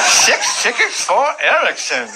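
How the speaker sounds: slow, high cleft-palate clerk imitation; cleft palate speech. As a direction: draw out the order with slurred s sounds. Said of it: "he has a cleft palate".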